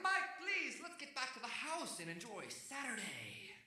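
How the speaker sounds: high-pitched, drawn-out pleading yell; yelling at dog. Retold or imitated. Owner calling his dog home, mock yelling. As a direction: plead with a runaway dog, hammed up. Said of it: "I start yelling at my dog".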